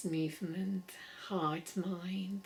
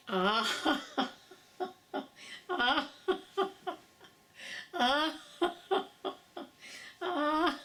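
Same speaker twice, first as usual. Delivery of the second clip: loud ah sounds breaking into laughs; ah vocal with laughs; 7 semitones higher, 3 dB louder, much slower than usual. Shown as a demonstration. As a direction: call out ah, laughing between. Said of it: "let's try with an ah".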